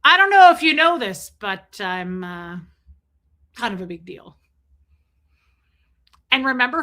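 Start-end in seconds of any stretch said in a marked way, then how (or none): 0.0-4.4 Ron Burgundy boast. Retold or imitated. Host quotes Ron Burgundy meeting Veronica, hammed-up boast. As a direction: boom it out then drop it smugly. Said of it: "he's like".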